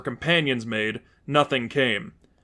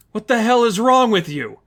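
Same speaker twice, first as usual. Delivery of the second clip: higher, louder furious demand; angry demand; 8 semitones higher, 5 dB louder than usual. Acted. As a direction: snap at her teammate. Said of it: "Miranda demanded".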